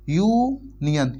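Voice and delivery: Union stretched very slowly; drawn-out sound. Shown as a demonstration. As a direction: draw out union for its first sound. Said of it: "union में क्या है".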